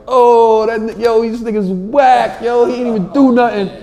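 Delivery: high, loud chorus of disappointment; jeering crowd. Acted. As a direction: heckle like a let-down audience. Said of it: "And the room was like".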